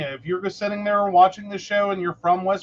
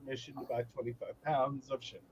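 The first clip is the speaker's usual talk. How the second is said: fast, low, much softer muttering; dismayed mutter; 8 semitones lower, 16 dB quieter, faster than usual. Retold or imitated. Retelling her regret, fast low soft mutter. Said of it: "And I went".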